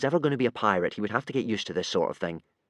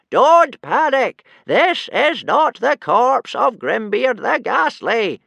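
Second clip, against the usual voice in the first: very high, very loud, slow shout; yelling; 13 semitones higher, 10 dB louder, much slower than usual. A narrator's reading. Wrinkly shouts over the panicking tribe, yelling. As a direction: bellow it so the whole panicking tribe hears. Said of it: "he started yelling".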